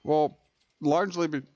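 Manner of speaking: talking with the hiccups; words broken by a hiccup. Real, not acted. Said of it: "excuse me, I've got the hiccups".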